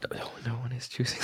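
whispered. Real, not acted. Mock-dejected aside, whispered.

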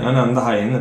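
usual speaking voice

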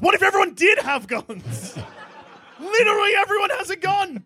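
high, excited, laughing; excited laughing rant. Real, not acted. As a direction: spill it out high and giddy. Excited rant about guns.

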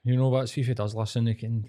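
normal talking voice

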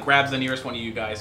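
ordinary talk, nothing marked

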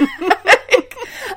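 high burst of laughter; a real laugh breaking out. Real, not acted. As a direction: crack up at the story. Laughing at the backpack theft.

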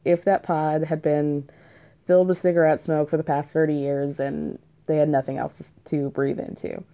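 ordinary talk

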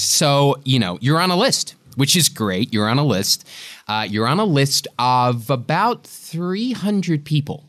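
put-on American accent, hedging; American agent. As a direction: break it to them in agent-speak American. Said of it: "fantastic impression of your American agent".

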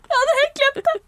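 squealing high through laughter; laughing shriek. Real, not acted. Laughing shriek remembering the sneeze. (hylende latter)

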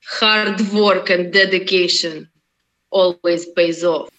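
thick Russian accent, rolled consonants. Shown as a demonstration. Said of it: "could you say that with your Russian accent".

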